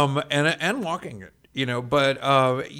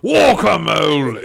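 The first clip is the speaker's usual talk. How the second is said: a loud, slow, hammed-up try at a British "Guacamole"; British accent attempt; 3 semitones higher, 13 dB louder, slower than usual. Shown as a demonstration. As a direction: stretch out "Guacamole" loudly in a bad British accent. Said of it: "I just keep trying to do it with a British accent".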